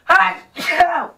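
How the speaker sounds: loud obnoxious sneeze; huge shrieking achoo, all out; sneezing. Shown as a demonstration. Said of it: "Really loud and obnoxious".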